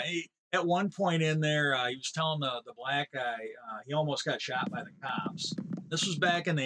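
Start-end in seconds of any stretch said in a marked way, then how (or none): none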